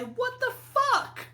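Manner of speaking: high, slow, squealing what the fuck; outraged squeal. Acted. Player voicing his crying character, high squealed outrage. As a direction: shriek it in teary disbelief. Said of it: "I look over at him and I say".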